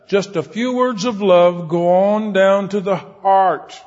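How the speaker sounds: high, loud preaching, slowing on key lines; full-force sermon delivery; fervent preaching. Acted. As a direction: drive the point home from the pulpit. Preaching with rising fervor.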